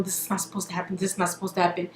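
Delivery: Ray J muttering as he walks off; mumbled to himself. Retold or imitated. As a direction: mutter it like he is walking away.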